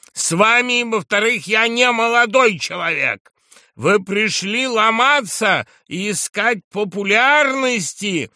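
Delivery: high, loud accusing tirade; furious shouting. A narrator's reading. Drunk journalist shouts back at the general, furious shouting. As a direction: fire back angrily at full voice. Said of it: "продолжал кричать с неудержимой яростью".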